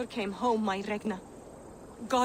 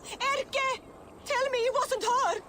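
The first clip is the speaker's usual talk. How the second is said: panicked fear; slower than usual. Acted. Mother fearing her daughter is among the dead, frantic panic. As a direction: blurt it out in a rush of dread. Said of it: "Take a breath".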